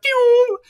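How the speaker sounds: very high, sharp laser pew. Retold or imitated. Retelling a film to a friend, zapping a high laser pew. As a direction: zap it out like a cartoon beacon. Beacon sound in retold movie.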